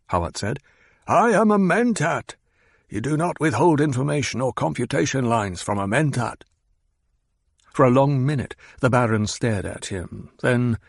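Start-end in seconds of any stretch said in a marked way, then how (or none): 1.1-6.4 higher, emphatic I; proud assertion. Acted. Hawat asserts his Mentat rank to the Baron, emphatic. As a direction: claim your rank.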